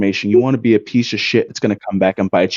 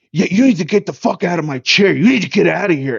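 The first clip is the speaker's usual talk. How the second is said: higher-pitched, confrontational order to leave; imitated aggressive demand; 6 semitones higher than usual. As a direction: mimic a player aggressively ordering someone off. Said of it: "he starts getting aggressive".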